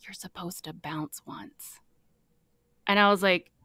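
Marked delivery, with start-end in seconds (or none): 0.1-1.8 soft whispered instruction in the ear. Retold or imitated. Bill whispering in her ear at dinner, hushed. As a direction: lean in and breathe it, slow. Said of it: "he bends down to my ear".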